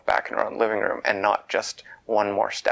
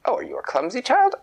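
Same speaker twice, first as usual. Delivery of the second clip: put-on high and louder, looking down on the child; a scornful royal question, pitched up; haughty queen; 12 semitones higher, 5 dB louder, faster than usual. A narrator's reading. Queen voiced in a story.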